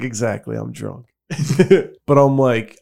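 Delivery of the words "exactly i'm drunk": quiet deadpan. Retold or imitated. Retold deadpan drunk reply.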